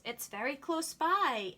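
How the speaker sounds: plain reading voice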